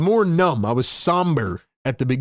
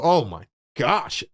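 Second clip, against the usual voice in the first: ordinary talk, nothing marked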